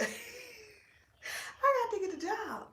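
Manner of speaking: high, slow, laughing repeat. Retold or imitated. Repeating the comedian's line to viewers, high and laughing. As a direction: stretch it out through a laugh. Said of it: "So say".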